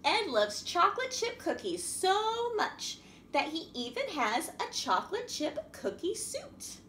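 put-on lively, sing-song storybook reading for little kids; reading to children. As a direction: read it like a picture book to a class. Reads a picture story to children.

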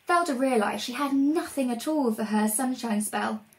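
usual speaking voice

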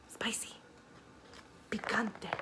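hushed unvoiced spicy; whisper. Reacting to chili peppers for a child, whispered. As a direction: whisper it with a wince.

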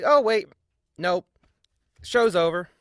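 ordinary delivery

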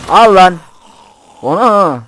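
loud, high fighting shouts; battle yell. Real, not acted. Gamer yelling at enemies while fighting them, loud shouts. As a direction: yell at them as you strike.

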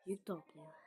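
soft whispered aside. Real, not acted. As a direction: whisper it under your breath. Aside while playing.

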